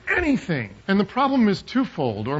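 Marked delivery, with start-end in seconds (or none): 0.1-0.7 "anything" said high, with exaggerated emphasis; single out "anything", pitched well up; stress on anything. Shown as a demonstration. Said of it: "The emphasis on this word".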